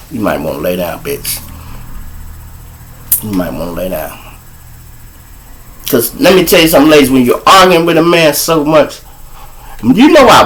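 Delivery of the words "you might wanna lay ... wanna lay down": low, soft menace; quiet threat. Retold or imitated. Him telling baby mama to lie down, low and menacing. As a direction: warn her softly and coldly.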